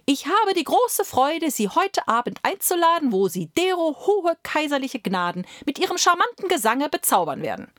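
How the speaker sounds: high, ornate courtly invitation; flowery court steward. Acted. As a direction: flatter the bird with pompous politeness.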